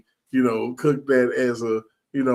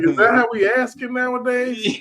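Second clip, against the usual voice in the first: high, mocking incredulous question; high sarcastic disbelief; 11 semitones higher, 3 dB louder than usual. Mocking the stranger's question for his co-host, high sarcastic disbelief. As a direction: act baffled by the stranger's manners.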